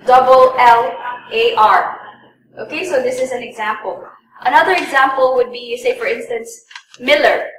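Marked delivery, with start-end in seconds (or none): 0.0-2.0 slow spelling with double. Shown as a demonstration. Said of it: "some people would say".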